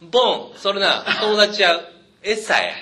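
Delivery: put-on loud Osaka dialect bark at a boy; Osaka old man. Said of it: "大阪のおっさんや、完全に".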